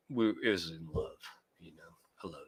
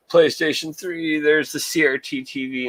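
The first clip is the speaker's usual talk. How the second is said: higher, louder and slowed down in delight; excited reveal; 7 semitones higher, 6 dB louder than usual. Real, not acted. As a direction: show off the prize of the shelf. Gasps, showing off console collection.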